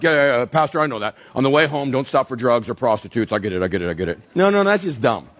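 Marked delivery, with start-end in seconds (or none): 0.0-4.2 mock know-it-all churchgoer waving off the pastor; dismissive congregant. Pastor mimics a listener brushing him off, lower and lower. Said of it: "some of you are like".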